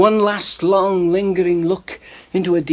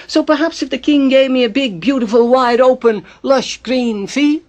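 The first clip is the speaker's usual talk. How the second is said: higher, louder, hopeful wheedling; angling for reward; 7 semitones higher, 4 dB louder than usual. Acted. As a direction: stretch out the wish list grandly, pitched up. Spider angles for a royal reward.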